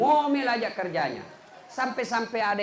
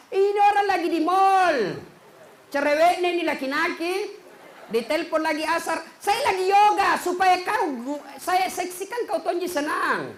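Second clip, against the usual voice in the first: high, loud shrill-free scolding wife; mock nagging wife; 8 semitones higher, 5 dB louder than usual. Wife scolding her husband on the phone, high and loud. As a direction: snap at him like a nagging wife.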